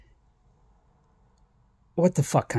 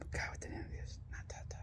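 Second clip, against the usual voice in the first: whisper; 26 dB quieter than usual. Real, not acted. Searching memory under breath.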